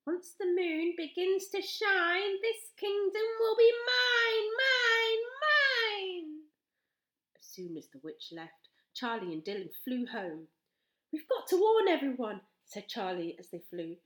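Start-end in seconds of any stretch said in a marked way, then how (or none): 0.0-6.5 high sing-song cackling witch; gloat, half singing the rhyme; gleeful witch. A narrator's reading. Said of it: "The witch cackled happily to herself". 11.1-12.5 high, loud urgent voice; alarmed, rushing to warn; urgent girl. A narrator's reading. Urgent girl flying home.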